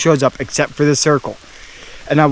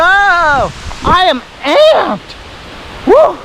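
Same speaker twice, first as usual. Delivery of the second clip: excited; 11 semitones higher, much slower than usual. Real, not acted. Hiker hyped, shouting woo.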